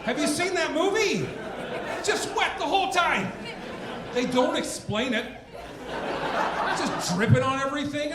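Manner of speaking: high, loud incredulous rant; comic rant. Real, not acted. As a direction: push it up high and loud in disbelief. Comic rant about wet alien.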